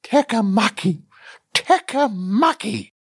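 a touch of weeping in the voice; mournful muttering. A narrator's reading. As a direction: moan the name over and over. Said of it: "and muttering".

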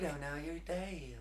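creepy, hushed Southern imitation; soft-spoken Southern accent. Podcaster mimics the preacher for co-host, soft Southern accent. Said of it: "That soft-spoken Southern accent to me is so".

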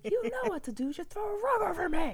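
very high, theatrical plea; hammed-up damsel voice; mock melodrama. Telling husband how to calm her.

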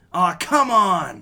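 high, loud protest; loud, high mock objection; mock outrage. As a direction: cry out in playful exasperation. Mock protest at friend's choice.

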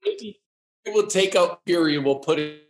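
choppy, cutting-out connection; broken-up internet audio; glitching audio. Real, not acted. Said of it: "Is this my internet or your internet?".

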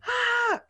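high hammed-up kid wail; screamed wail. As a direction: let out a scared child's wail. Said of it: "Empecé a gritar y a gritar".

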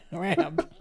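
ordinary delivery

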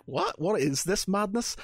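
high-pitched, incredulous retold question; mock bafflement. Telling how he reacted to her loving his accent, mock bafflement.

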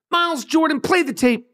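high call across the room to the producer. Real, not acted. Host calls his producer Miles Jordan to play the tape, high calling voice. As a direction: sing out the order to play the tape.

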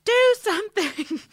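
high, loud hammed-up scream; mock scream. Ripley shakes a useless officer after the attack, imitated scream. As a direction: yell it like shaking someone into action. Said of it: "and screams".

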